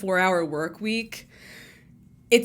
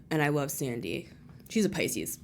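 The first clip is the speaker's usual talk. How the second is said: normal talking voice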